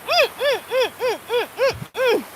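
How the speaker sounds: high, soft delighted mm sounds; pleasure moans. Retold or imitated. Eating a salad at the airport restaurant, delighted high mms. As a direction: hum with pleasure at each bite. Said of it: "literally making these noises".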